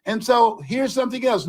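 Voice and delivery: normal talking voice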